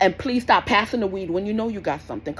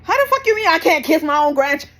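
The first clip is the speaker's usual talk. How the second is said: loud, higher, indignant grandparent cussing; irate grandparent; 7 semitones higher, 6 dB louder than usual. Retold or imitated. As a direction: imitate an outraged grandparent cussing you out. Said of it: "They get upset".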